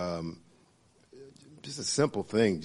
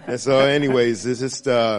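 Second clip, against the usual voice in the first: loud, higher, through laughter; laughing; 4 semitones higher, 10 dB louder than usual. Real, not acted. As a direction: carry on while still laughing at a joke. Laughing after the puppies joke.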